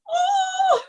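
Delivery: high yelp of surprise, cutting in out of nowhere; a startled oh, jumped right up in pitch; sudden surprised cry. Real, not acted. Speaker startled mid-update, a sudden high surprised cry.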